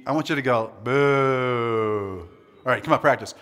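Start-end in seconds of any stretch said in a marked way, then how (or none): 0.8-2.3 long, lower drawn-out boo; sad boo. Shown as a demonstration. Preacher teaching the sad emoji response, mildly mournful boo. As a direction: let the boo sag and stretch. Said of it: "when you see the sad emoji".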